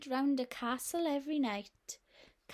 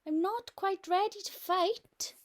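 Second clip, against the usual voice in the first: startled knight; 6 semitones higher, 4 dB louder than usual. Acted. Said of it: "who told the dragon".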